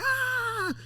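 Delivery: high-pitched squeal, held back in volume; mock scream. Imitating his friends after the concert, mock screaming.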